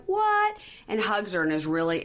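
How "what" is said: high, loud shocked what; surprised mom. Retold or imitated. Recapper voices the mom's shocked joy at the pregnancy news. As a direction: yelp it like a stunned mom. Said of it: "her mom's like".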